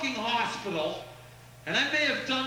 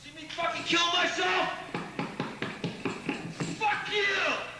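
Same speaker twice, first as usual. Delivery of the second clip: furious shout; 7 semitones higher than usual. Real, not acted. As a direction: scream it at the crowd. Shouting at audience.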